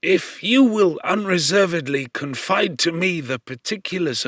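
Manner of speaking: lightly voiced old man soothing a stranger; gentle fatherly reassurance; reassuring old man. A narrator's reading. Old man offers help.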